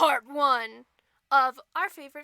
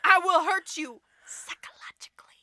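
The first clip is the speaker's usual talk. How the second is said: hammed-up sinister whisper; mock menacing whisper. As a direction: whisper the threat like a playful villain. Mock threat, unvoiced.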